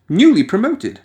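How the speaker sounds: ordinary delivery, nothing marked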